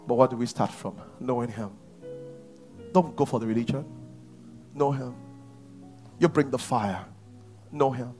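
ordinary delivery

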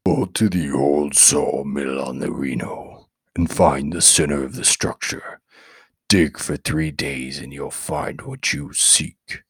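low, slow demonic rasp; deep demon voice. A narrator's reading. Demon inside the boy answering the necromancer, low and slow. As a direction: speak as a hostile demon from below.

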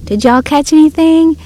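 retold yell. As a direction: call it out as if across the water. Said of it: "and yelled over here".

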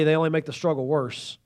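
ordinary delivery

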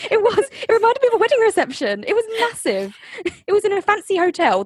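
high, gushing agreement; excited. Real, not acted. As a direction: pitch it up, gushing. Excited agreement, higher pitch.

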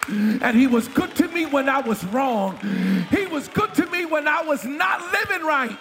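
fiery pulpit preaching; preaching at full force. Acted. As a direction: preach it out to the congregation. Preacher whooping over music.

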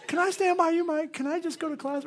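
high, meek little plea; timid pleading. Retold or imitated. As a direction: ask in a small, high voice for protection. Timid kid asks Mike.

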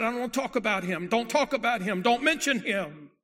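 loud, high, insistent protest; agitated refusal. Retold or imitated. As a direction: shout him down, pushing each demand harder. Possessed boy refuses to hear of Jesus.

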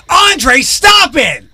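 high, loud scolding shout; yelling at the dog. Retold or imitated. Host scolding his dog with a loud shout. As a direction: snap at the dog to stop. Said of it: "Mine is more like".

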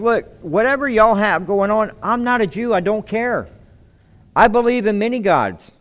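casual shrugging official; dismissive Pilate. Retold or imitated. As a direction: brush them off, not my problem.

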